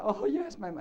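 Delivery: the patient suddenly hits on it, voice jumping up; sudden realisation. Retold or imitated. Patient suddenly recognises her problem.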